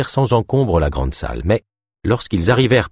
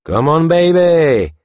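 high, loud, slow catcall; leering thug; 9 semitones higher, 4 dB louder, much slower than usual. A narrator's reading. Thug advances on Ava, sleazy catcall. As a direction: drag it out like a sleazy taunt.

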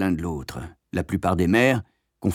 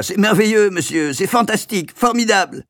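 much higher, louder, thrilled exclaiming; overjoyed; 11 semitones higher, 5 dB louder than usual. A narrator's reading. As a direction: burst with delight. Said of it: "en sautant de joie". (exclamations débordantes de joie)

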